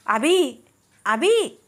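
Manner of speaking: high anxious shout rising on the second call; shouting a name. A narrator's reading. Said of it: "అని అరుస్తూ బయటికి నడిచాడు". (కంగారుగా అరుస్తూ పిలవడం)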